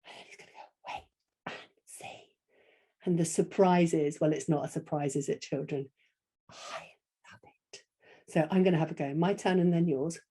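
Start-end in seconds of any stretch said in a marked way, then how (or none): 0.0-2.3 hushed, secretive whisper; voiceless storyteller's hush; suspenseful whisper. Shown as a demonstration. Suspense before the surprise line. 6.5-7.8 slow, breath-only whisper; hushed drawn-out reveal; whispered surprise. Shown as a demonstration.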